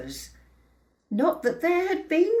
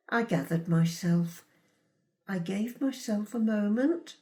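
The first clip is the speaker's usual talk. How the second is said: usual speaking voice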